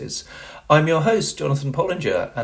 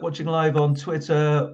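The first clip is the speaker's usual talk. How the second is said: ordinary talk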